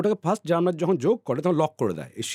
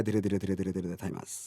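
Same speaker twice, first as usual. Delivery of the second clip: each ধীরে softer and lower, trailing off to almost nothing; 7 semitones lower, 8 dB quieter than usual. Shown as a demonstration. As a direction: let the repeated ধীরে die away as the thymus shrinks. Shows the gland shrinking slowly.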